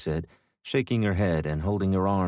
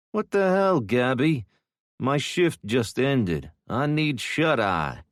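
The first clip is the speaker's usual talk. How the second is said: grumpy woken man; 6 semitones higher, 3 dB louder, slower than usual. Acted.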